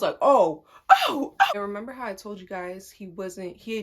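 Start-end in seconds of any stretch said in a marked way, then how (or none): 0.2-1.4 loud, escalating "oh"; mock exclamation. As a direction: blurt it out louder. Reacting loudly, opening clip.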